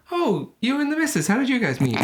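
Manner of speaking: high-pitched, put-on polite small talk; chatty dinner guest. Dinner guest asking a couple how they met, high put-on chattiness. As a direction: play a curious dinner guest, pitched well up.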